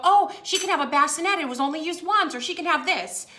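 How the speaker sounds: high-pitched imitation of generous neighbours; eager donors. Townsfolk offering baby things, retold.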